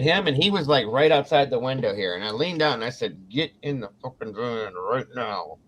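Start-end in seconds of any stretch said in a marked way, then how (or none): 3.3-5.6 slow, softer, dead-serious order to get in. Retold or imitated. To her husband outside the van, a slow hushed furious order. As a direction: lean in and quietly order him into the van.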